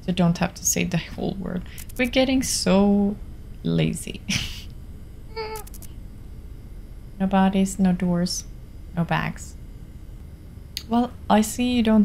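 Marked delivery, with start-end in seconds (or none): 4.2-7.1 high, soft, long laugh; real laugh. Real, not acted. Streamer amused at getting so lazy, soft high laugh. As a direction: let a quiet, high giggle run out.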